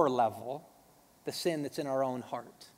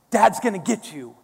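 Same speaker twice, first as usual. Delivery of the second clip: playful dad threat; 4 semitones higher, 9 dB louder than usual. Retold or imitated. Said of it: "I'll go".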